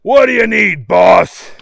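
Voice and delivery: loud henchman holler; yelling. A narrator's reading. Marco answering the boss's call, yelling. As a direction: call down the tunnel to the boss. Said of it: "he yelled".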